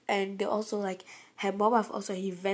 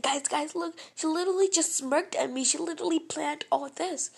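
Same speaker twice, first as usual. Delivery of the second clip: urgent protest; 6 semitones higher than usual. Retold or imitated. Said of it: "I was like".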